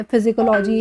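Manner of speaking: "फिजिकोलॉजी" with an F sound at the start; low-pitched misreading "फिजिकोलॉजी", full LO-jee ending. Shown as a demonstration. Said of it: "कुछ इस तरह का नहीं बोलना है".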